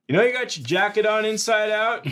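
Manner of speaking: high, loud shout; calling out. Acted. Lookout shines a flashlight on Tom, calling out. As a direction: shout it across at a stranger.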